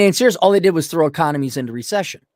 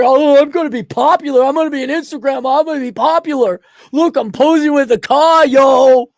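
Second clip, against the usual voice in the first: mocking show-off; 6 semitones higher, 5 dB louder than usual. Mocking young show-offs.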